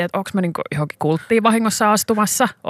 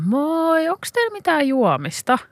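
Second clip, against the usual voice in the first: retold customer greeting; 7 semitones higher, slower than usual. Retold customer answering the shouted welcome.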